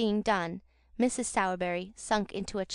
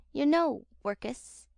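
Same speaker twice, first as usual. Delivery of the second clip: higher, mocking fake sympathy; jeering affected pity; 5 semitones higher, slower than usual. A narrator's reading. Noah taunts Oliver with mock pity, jeering. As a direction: pour on the false pity to wound. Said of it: "in a jeering tone of affected pity".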